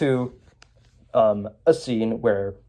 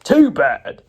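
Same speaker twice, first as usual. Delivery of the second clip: gruff bad cop; 2 semitones higher, 5 dB louder than usual. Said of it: "Bad Cop's like".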